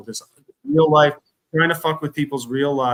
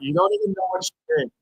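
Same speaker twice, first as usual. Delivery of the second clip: exasperated; 13 semitones higher, faster than usual. Real, not acted. Repeating louder at chat.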